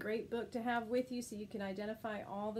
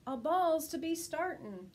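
loud excited call; yelled; 6 semitones higher, 5 dB louder than usual. Acted. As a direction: call it out to the crowd. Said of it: "someone yelled loudly".

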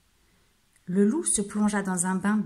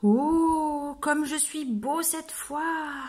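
higher, slow, preening; admiring himself; 6 semitones higher, much slower than usual. Acted. Proud wolf admiring himself in the mirror, preening. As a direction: savour his own beauty.

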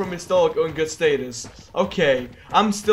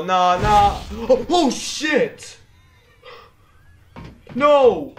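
rising panicked no, then a shout; scared shout at a jump scare; panicked cry; 3 semitones higher, 4 dB louder, much slower than usual. Real, not acted. Jump scare in horror game.

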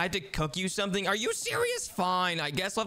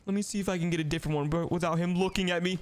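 normal talking voice

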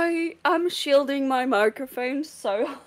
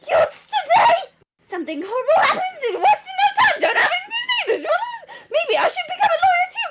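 shrill high yelling, fast and breathless; high, fast, loud old woman ranting at tourists; screaming old woman guard; 11 semitones higher, 3 dB louder than usual. Acted. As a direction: rant at the youths, much higher and faster. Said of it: "I will be screaming".

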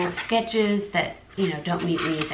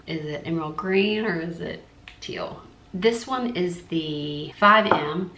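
ordinary talk

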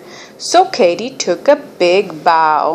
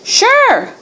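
very high little frog voice; frog croak; 13 semitones higher than usual. A narrator's reading. Frog agreeing to help the princess, croaking. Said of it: "croaked the frog".

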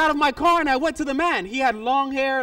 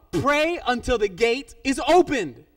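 slow, deliberate "Pray until the gate is opened"; insistent instruction; 4 dB louder, slower than usual. Retold or imitated. Pastor insistently repeats his instruction.

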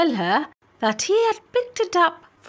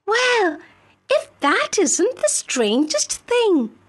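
put-on much higher, bright girl's voice; eager young maid; 8 semitones higher than usual. Filippipa character voice.

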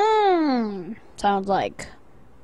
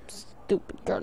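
whispered insult under breath; hushed mean aside; whispering; 6 semitones lower, 14 dB quieter, slower than usual. Real, not acted. Muttered mock insult to character.

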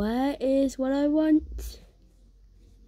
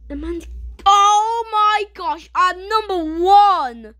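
excited; 6 semitones higher, 5 dB louder, slower than usual. Retold or imitated. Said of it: "I said".

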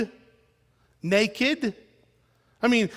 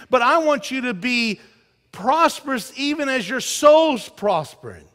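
preaching scripture; 4 semitones higher, 6 dB louder than usual. Acted. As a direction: lean on each word like a proclamation. Reading scripture with force.

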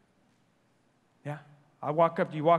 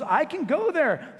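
entitled royal; 10 semitones higher, 6 dB louder, slower than usual. Retold or imitated. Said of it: "royalty goes".

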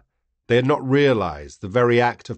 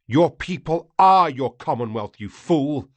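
shout; 5 semitones higher than usual. Acted. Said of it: "I shouted".

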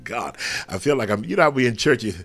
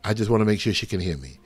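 hurried check; faster than usual. Real, not acted. Checking caller on stream.

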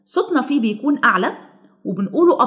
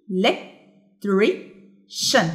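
electrician broken into four slow, separated syllables; much slower than usual. Shown as a demonstration. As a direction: say electrician in isolated beats with pauses. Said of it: "تعالوا نشوف كده". (مقطّعة ببطء إلى مقاطع)

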